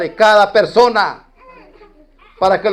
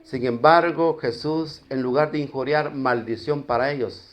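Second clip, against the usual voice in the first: ordinary talk, nothing marked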